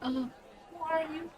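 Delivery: put-on very high, soft, slow kid voice; staring small child. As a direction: ask slowly in a tiny child's voice. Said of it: "he just kind of stares".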